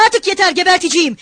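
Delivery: high-pitched burst of fed-up anger; angry cry. A narrator's reading. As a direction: call it out, fed up and threatening. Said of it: "diye bağırıyordu Mikolka".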